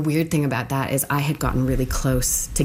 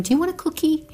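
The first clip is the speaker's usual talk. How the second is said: high sweet voice; faster than usual. Retold or imitated. Inmates offering cookie.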